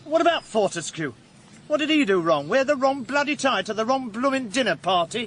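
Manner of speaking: high-pitched, heated mockery; worked-up, scornful, voice high; scornful challenge. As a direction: needle him with indignant questions. Sneering challenge in quarrel.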